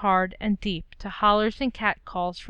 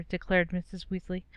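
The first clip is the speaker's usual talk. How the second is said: ordinary delivery, nothing marked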